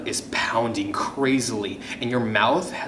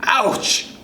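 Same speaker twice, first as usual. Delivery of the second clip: high yelp on landing; sharp, high-pitched ouch; pained yelp; 9 semitones higher than usual. Acted. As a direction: cry out from the bump. Child landing after a fall.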